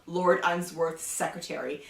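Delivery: ordinary delivery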